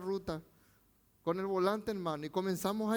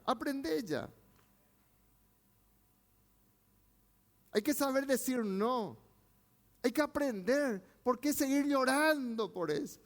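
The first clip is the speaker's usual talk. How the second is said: raised, driving preacher voice; fiery preaching; 8 semitones higher than usual. Acted. Pastor exhorting his congregation, fiery preaching. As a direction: preach it high and hard to the church.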